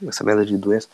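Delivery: hoarse, fading voice; voice giving out. Real, not acted. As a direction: speak through a failing voice. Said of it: "minha voz tá indo embora".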